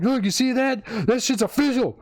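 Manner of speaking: high-pitched mock triumphant boast; in-her-face gloating. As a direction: imitate a man rubbing it in at his girlfriend. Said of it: "got in her face".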